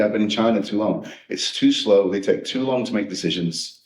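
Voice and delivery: ordinary talk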